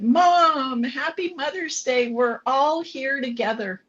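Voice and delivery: high, lilting imitation of the kids' greeting; cheery phone greeting. Kids phoning their mom on Mother's Day, cheery greeting.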